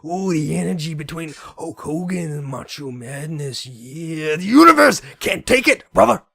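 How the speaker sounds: loud, high, growing wrestler promo yell; cranked-up wrestling promo voice; Hulk Hogan impression. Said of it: "taking a sense of".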